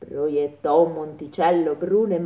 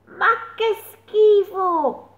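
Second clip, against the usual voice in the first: slow, high disgusted exclamation of the little mole; little mole's disgusted cry; 13 semitones higher, much slower than usual. A narrator's reading. The little mole finds it on her head, disgusted. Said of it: "esclamò la piccola talpa".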